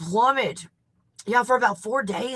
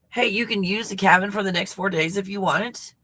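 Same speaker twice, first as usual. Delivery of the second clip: ordinary talk, nothing marked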